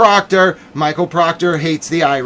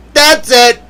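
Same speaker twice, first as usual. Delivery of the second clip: a higher, firm falling "That's it"; mock outburst; 5 semitones higher, 4 dB louder than usual. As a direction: slam the door on it, in jest. Mock anger peaking.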